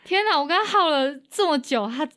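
high, loud overwhelmed voice; tearful relief; moved to tears. Real, not acted. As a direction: sound relieved and almost crying. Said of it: "我眼泪也快掉出来了".

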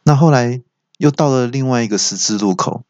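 normal talking voice

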